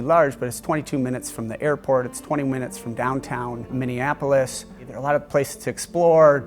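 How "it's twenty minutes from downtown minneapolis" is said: usual speaking voice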